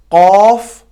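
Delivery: कॉफ said loud and heavy with rounded lips; होंठ गोल करके भारी कॉफ; heavy qaf. Shown as a demonstration. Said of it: "होंठ गोल".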